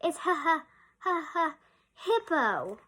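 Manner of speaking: laughing out a name, gasping; gasping laughter. Acted. Rhinoceros confessing his name, gasping with laughter. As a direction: gasp through giggles to get the name out. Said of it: "gasped the rhinoceros".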